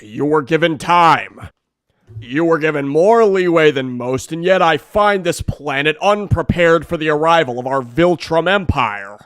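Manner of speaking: loud, commanding alien warlord; menacing conqueror. Acted. Conquest scolding the hero, loud menace. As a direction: boom down at a lesser being.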